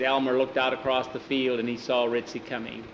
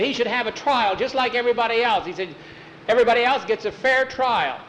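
put-on high, raised boyish argument; boy's insistence; 10 semitones higher, 3 dB louder, faster than usual. Boy demanding a fair trial for his dog, high insistent voice. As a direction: press the case in a high voice.

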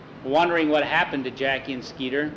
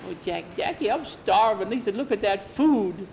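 put-on high, whiny kid voice from hiding; hungry boy; 6 semitones higher than usual.

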